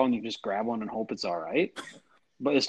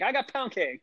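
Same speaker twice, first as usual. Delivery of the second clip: auctioneer; 11 semitones higher than usual. Retold or imitated. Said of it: "like an auction".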